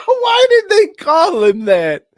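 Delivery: loud, high mock outrage; incredulous shout. Baffled friend yelling about the nickname, high loud shout. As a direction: shout the question up high in disbelief.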